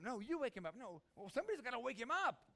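high, loud squabbling voices; high, loud disciples arguing over who wakes him; bickering disciples. Acted. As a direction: pass the job off, squabbling.

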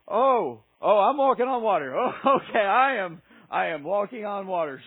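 loud, wobbling realization; hammed-up stunned disbelief; dawning alarm. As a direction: let the thrill drain into uh-oh. Peter's dawning realization, then sinking.

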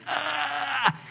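high drawn-out cry of realization; startled gasp-cry. Acted. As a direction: cry out in sudden alarm. Peter startled at the rooster crow.

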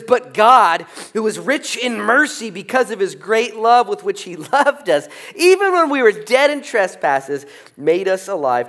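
high, loud, ringing scripture reading; full-force preach. Real, not acted. Pastor booming out verse four to the church. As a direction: proclaim the verse to the rafters.

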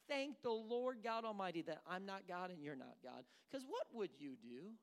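usual speaking voice

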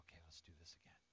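low, hushed, tender encouragement; gentle reassurance. Acted. Jesus encouraging someone who fell, gentle and hushed. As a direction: soothe softly, close to a whisper.

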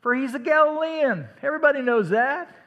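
high, loud scornful accuser in the courtyard; accusing bystander. Acted. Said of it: "another, uh, asserted".

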